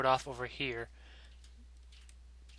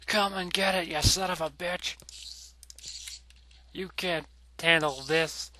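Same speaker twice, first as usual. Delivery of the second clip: taunting; 3 semitones higher than usual. Acted. Taunting the spider in battle.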